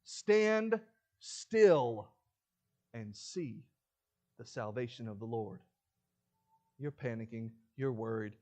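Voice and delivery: usual speaking voice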